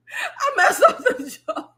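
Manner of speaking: high, slow words squeezed out through laughter; laughing. Real, not acted. Admitting she ruined the joke, laughing high. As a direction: laugh helplessly at her own mistake.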